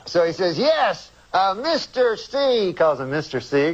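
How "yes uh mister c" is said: high, slow Cosby voice; slow and high, Cosby style; Cosby-like agent voice. Retold or imitated. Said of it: "you start to talk like him".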